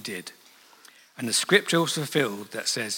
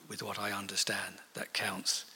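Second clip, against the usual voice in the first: whisper; 6 semitones lower, 14 dB quieter than usual. Real, not acted. Preacher whispers the key point.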